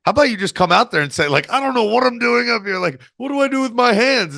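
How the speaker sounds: high, breezy imitation of the coach's advice; imitating Dusty. Coach advising a nervous wrestler, high imitated voice. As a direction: voice the old coach suggesting she admit her nerves. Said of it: "It was like".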